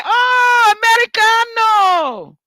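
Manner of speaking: high, loud, gushing welcome; delighted greeting. Retold or imitated. Italians greeting an American tourist, high gushing delight. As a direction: fawn over the American. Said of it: "they say".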